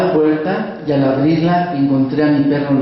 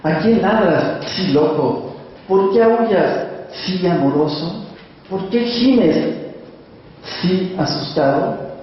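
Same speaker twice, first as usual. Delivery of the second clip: high, slow, coaxing questions; tender questions to the dog; 5 semitones higher, slower than usual. Acted. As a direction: ask the shivering dog gently. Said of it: "le dije entre sotil y castellano".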